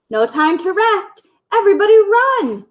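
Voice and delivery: high, fast urgent call; urgent shout. Acted. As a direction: hurry them off, fast and high. Hen urging others to flee.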